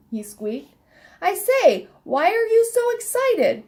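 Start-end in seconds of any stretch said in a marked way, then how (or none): 1.2-3.6 high-pitched storybook bird, asking keenly; insistent animated question. A narrator's reading. Miss Sparrow repeats herself to the squeaking elf, high insistent question. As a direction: lift the whole question high and press for an answer.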